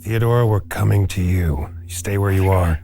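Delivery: usual speaking voice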